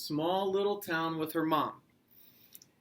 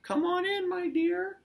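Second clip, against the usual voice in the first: very high, loud falsetto granny; exaggerated high mock-granny welcome; wolf pretending Grandma; 5 dB louder than usual. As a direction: call out in a fake granny falsetto. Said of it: "he pretended like he was Grandma".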